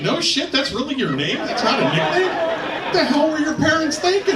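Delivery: high-pitched comic disbelief; incredulous. Acted. Comic reacting to a recruit named Cooter, incredulous. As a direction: question it in mock astonishment, voice climbing.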